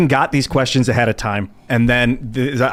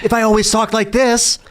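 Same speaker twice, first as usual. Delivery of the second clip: high-pitched mock whine; whining imitation; 6 semitones higher than usual. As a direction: put on an annoying, whiny tone. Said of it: "Such an annoying tone".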